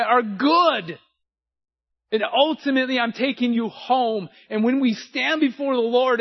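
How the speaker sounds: impassioned pulpit preaching; preaching at full force. Acted. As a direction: build the sermon to a passionate peak.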